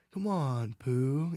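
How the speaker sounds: lightly voiced gloomy donkey, sighing; Eeyore voice. Guest imitates Eeyore moping to Pooh, lightly voiced. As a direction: droop the words like a sad donkey. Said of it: "It's a good voice impersonation".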